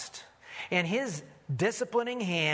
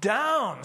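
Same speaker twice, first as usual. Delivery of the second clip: "down" raised, then dropped hard; emphasis on "down"; 5 semitones higher, 4 dB louder than usual. Shown as a demonstration. Preacher reading where Jonah laid, emphatic "down". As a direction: punch "down" after a short pause. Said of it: "I'll emphasize the key words".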